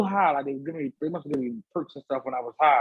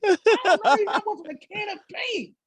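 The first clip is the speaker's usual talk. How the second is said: high, hammed-up yell; a retold outburst, pitched way up; mock shout. Shouting at the blacking-out boys.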